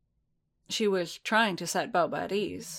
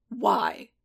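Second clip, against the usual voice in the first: low, hard growl of a question; deep menacing voice; 12 semitones lower than usual. Acted. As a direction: Boba demanding why, low and cold.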